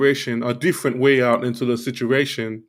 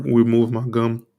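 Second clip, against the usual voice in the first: ordinary talk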